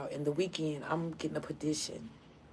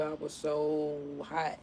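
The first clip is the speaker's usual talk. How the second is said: ordinary talk, nothing marked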